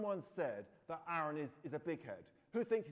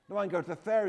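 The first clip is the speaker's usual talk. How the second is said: put-on raised, louder boyish exclamation; crying out; 6 semitones higher, 14 dB louder, much faster than usual. A narrator's reading. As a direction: cry it out, higher and louder. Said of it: "he cries".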